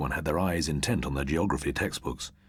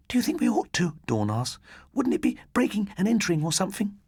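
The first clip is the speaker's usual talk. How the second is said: put-on nervous girl voice. Nervous Dawn voiced high.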